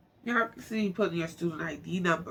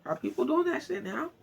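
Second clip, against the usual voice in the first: incredulous; 11 semitones higher than usual. Real, not acted. Reacting to search results.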